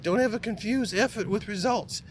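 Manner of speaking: high-pitched mock boss voice; Bob Rohrman impersonation. Speaker quoting his boss's warning about effort, terrible high-pitched impersonation. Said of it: "that's kinda how he sounded".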